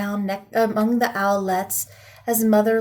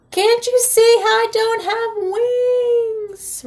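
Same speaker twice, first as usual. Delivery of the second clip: much higher, slow screech; 12 semitones higher, slower than usual. A narrator's reading. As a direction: squeal it out in slow alarm. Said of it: "Rollo screeched".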